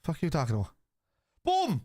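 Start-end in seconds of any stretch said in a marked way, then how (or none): 1.4-1.8 sudden high excited shout of joy; goal celebration. Real, not acted. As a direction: yell it out as the ball hits the net.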